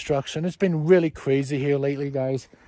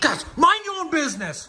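laughing snap; 12 semitones higher than usual. Acted. Dad snaps at kids filming.

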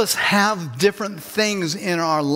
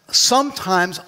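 usual speaking voice

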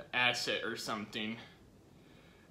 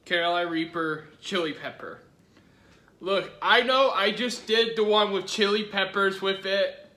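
energetic vlog intro; 6 semitones higher, 8 dB louder than usual. Acted.

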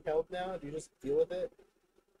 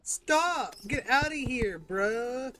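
annoyed yell; 7 semitones higher, 4 dB louder than usual. Real, not acted. Yelling at cat.

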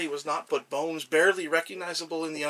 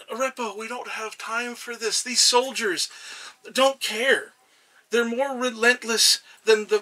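urgent pleading god; 5 semitones higher, 5 dB louder than usual. Acted.

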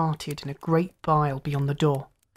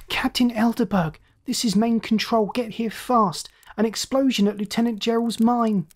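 higher, urgent, strained lookout shout; shouting from crow's nest; 6 semitones higher than usual. Acted. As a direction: yell the alarm from the lookout. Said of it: "strained with excitement, shouting".

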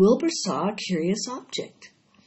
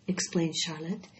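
ordinary delivery, nothing marked

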